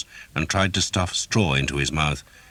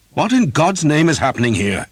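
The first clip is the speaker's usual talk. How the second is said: much higher, loud outraged call; demanding priest; 12 semitones higher, 7 dB louder than usual. A narrator's reading. Priest bursting into the yard, loud outrage. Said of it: "the man asked".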